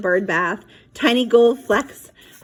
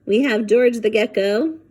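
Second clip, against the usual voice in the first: usual speaking voice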